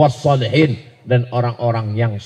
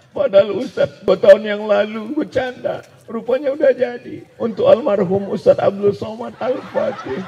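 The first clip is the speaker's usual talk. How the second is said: high-pitched, weepy mother's voice; mock tearful; 10 semitones higher than usual. Bakwan seller moved that the joked-about mosque came true, tearful. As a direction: act out a woman choked up with tears. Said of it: "barulah ibu meneteskan air mata".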